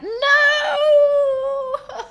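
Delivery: loud laughing scream of dismay. Real, not acted. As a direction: shriek with a laugh. Spills food on clothes.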